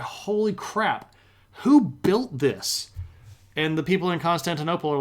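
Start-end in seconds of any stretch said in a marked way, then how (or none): 0.0-2.9 drawn-out gasp of wonder at a huge building; stunned awe. Retold or imitated. Crusader seeing Hagia Sophia, slow amazed exclaim.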